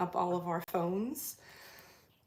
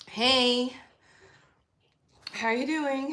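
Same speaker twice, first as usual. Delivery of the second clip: loud, higher hey to a viewer; greeting a regular viewer, upbeat and loud; cheery greeting; 5 semitones higher, 6 dB louder than usual. Real, not acted. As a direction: welcome them warmly and loudly. Greeting another chatter.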